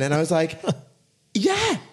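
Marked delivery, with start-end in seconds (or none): 1.3-1.8 very high put-on American yeah; fake American accent. Bluffing to a blind date, high fake American accent. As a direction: pitch it way up in a mock American accent. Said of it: "did you pretend that you were American".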